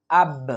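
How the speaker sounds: আব with a bounced release on the final b; light qalqalah push. Shown as a demonstration. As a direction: kick lightly off the ব ending আব. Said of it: "হালকা ধাক্কা দিয়ে".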